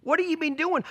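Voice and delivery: mock furious tirade; retold angry cussing. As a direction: chew him out like an angry boss. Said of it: "cusses me out".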